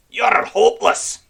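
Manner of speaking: fed up with them all; groaned. Acted. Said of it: "Red Ram groaned".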